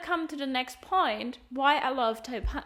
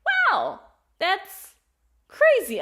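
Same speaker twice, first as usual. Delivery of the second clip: amazed exclamation; 8 semitones higher, 8 dB louder, slower than usual. Retold or imitated. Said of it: "where I felt like".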